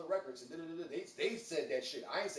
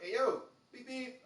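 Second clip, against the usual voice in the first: calling out; 10 semitones higher, 6 dB louder, slower than usual. Retold or imitated. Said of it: "He dropped that motherfucker".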